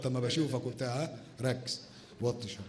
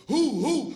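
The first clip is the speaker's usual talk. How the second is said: loud, high drawn-out hoo-hoo; whooping hoot; 13 semitones higher, 9 dB louder, much slower than usual. Acted. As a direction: hoot the company name like a siren. Host hoots the sponsor's silly name. (صياح هو هو عالي وممطوط)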